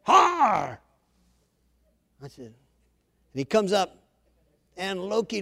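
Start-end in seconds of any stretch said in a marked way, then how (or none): 0.0-0.8 fierce, high monster roar; drawn-out beastly groan; Hulk groan. Acted. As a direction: let out a fierce imitation roar. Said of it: "Loud groans, fierceness".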